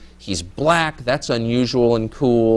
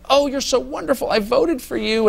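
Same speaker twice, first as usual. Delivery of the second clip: high, mock-adoring gush; gushing reporters; 11 semitones higher than usual. Reporters fawning over Obama, high mocking gush. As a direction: coo it like a starstruck fan. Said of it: "all these reporters are like".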